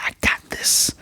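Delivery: breathy determined whisper; quiet confident whisper, imitating Anya; whispered resolve. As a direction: whisper it with a serious face. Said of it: "She, she like".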